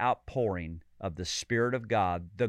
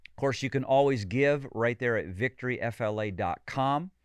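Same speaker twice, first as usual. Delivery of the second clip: ordinary talk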